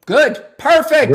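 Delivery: high, loud mock delight; sarcastic glee. Real, not acted. Host mocking caller's birth-rate worry with fake delight. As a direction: cheer the bad news with biting sarcasm.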